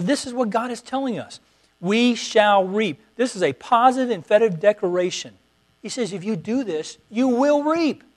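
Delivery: fervent preaching. Acted. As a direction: preach it hard, pushing the congregation on. Preaching at full force.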